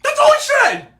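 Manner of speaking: very high, loud squeal of disbelief; incredulous yelp; incredulous outburst. Real, not acted. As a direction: shriek it in laughing disbelief. Exasperated laughing outburst.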